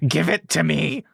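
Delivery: high, shrieking demand from a swamp hag; creature screaming. A narrator's reading. Swamp creature lunging at the pregnant leader, high screech. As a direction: screech it like a greedy monster grabbing. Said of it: "She screams at you".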